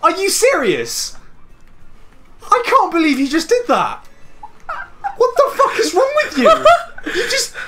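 high, loud outraged cry; disbelieving outrage, half laughing. Real, not acted. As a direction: shriek at the friend who wrecked the race. Raging at friend in game.

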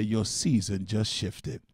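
slow, deliberate line to repeat; slow call. Shown as a demonstration. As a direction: give them the words to echo. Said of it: "Look at your neighbor and say".

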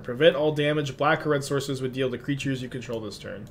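ordinary talk

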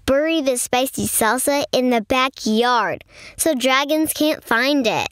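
ordinary delivery, nothing marked

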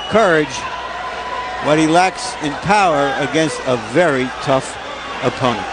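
usual speaking voice

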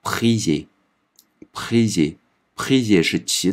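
prier stretched very slowly for the class; slow French demo. Shown as a demonstration. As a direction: draw out prier syllable by syllable. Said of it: "拼起来". (拉长慢读prier)

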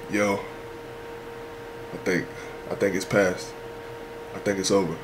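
very soft, low whisper-quiet; hushed. Retold or imitated. Scouts in tent after storm, hushed voice. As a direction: ease the words out after the storm.

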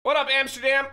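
hyped hello to Amsterdam viewers; loud greeting. Real, not acted. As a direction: call out to the city.

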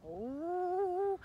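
high, loud wailed "oh"; fearful moan. A narrator's reading. Gargoyle imagining being caught by the master, wailing. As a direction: wail in dread, rising high.

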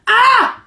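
sudden high, loud yelp; short high-pitched acted shriek; retold scream. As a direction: let out a mock scream of pain. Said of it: "I'm, like, screaming".

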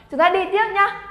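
ordinary delivery

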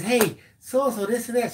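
high-pitched mock chatter; imitated wife. Husband mimics wife chatting at the board, high-pitched. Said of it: "she says".

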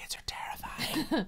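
high, breathy spooky whisper; playful creepy whisper; mock spooky whisper. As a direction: hiss it like a campfire tale. Whispered comment on scary kids.

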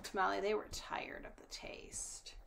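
usual speaking voice

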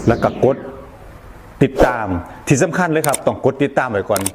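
loud upbeat sign-off; punchy channel outro read; announcer. Acted. Subscribe call to action.

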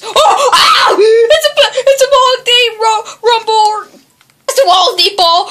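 loud, high yelp of alarm; scream, then blurt out the danger; high-pitched panicky shouting; panicked scream. Real, not acted. Sudden danger in game.

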